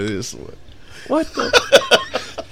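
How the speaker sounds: ordinary talk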